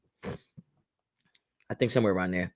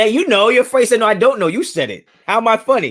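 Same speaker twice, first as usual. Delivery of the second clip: higher, louder mock menace; mock Pesci confrontation; 6 semitones higher, 4 dB louder than usual. Host acts out the Pesci funny-guy scene, higher, louder mock menace. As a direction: play the touchy gangster, half joking.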